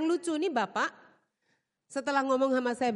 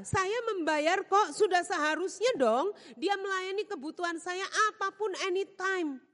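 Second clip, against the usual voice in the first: entitled boss; 8 semitones higher than usual. Retold or imitated. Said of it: "pikirannya apa?".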